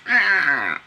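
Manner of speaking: loud, dragged-out needle scratch; record-scratch noise. Shown as a demonstration. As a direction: imitate a needle ripped across a record. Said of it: "like you take the needle on the record".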